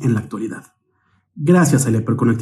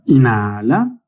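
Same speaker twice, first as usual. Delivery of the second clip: unhurried meditation guide, long pauses between cues; guided meditation. Real, not acted. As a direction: guide the breathing calmly, stretching out each cue word. (meditación guiada, con calma y largas pausas)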